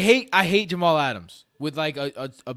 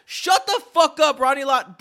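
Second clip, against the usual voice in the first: angry outburst; 10 semitones higher, 4 dB louder than usual. Real, not acted. Ranting at the player.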